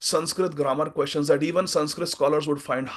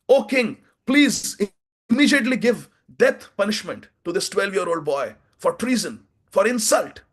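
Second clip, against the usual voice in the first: indignant outrage; 7 semitones higher than usual. Acted. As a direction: demand punishment in a shocked, high voice. Kolahal demands the boy's death.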